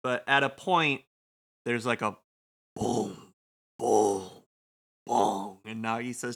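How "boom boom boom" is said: low, spaced-out booms; imitated booms. Narrator mimics booms heard during scene, low. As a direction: voice three deep booms with pauses. Imitates distant booming sound.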